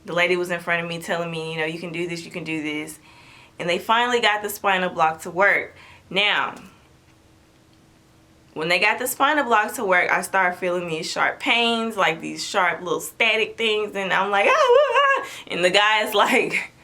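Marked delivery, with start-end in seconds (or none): 1.6-3.0 soft coaxing repeat, much softer; gentle encouragement. Retold or imitated. Nurse coaxing the patient, soft encouragement. 14.5-15.3 high sharp cries of pain, reliving it; yelping at sudden static pains; pained gasps. Retold or imitated. To the doctors, high pained yelps.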